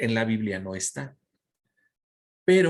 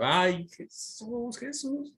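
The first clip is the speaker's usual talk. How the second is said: mock yell; 10 semitones higher, much slower than usual. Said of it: "imagínate a María gritando".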